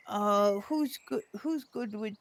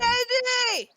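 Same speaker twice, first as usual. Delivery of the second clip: calling out; 10 semitones higher, 5 dB louder than usual. Acted. Calling out to sleeper.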